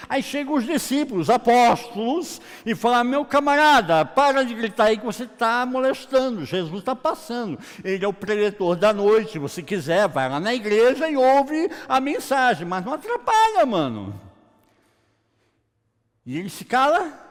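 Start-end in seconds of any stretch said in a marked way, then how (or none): 3.0-14.2 loud, high-pitched mock telling-off; exasperated scolding. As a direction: hush a noisy nuisance with raised voice. Disciples scolding the shouting beggar. (bronca exasperada em voz alta e aguda)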